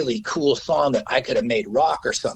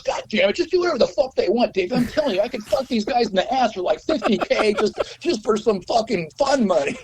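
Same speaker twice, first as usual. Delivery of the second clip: high, fast, swearing rant; pitched-up lawyer ranting excitedly; fired-up lawyer; 9 semitones higher than usual. Retold or imitated. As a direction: push him on, cussing and pumped. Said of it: "he goes".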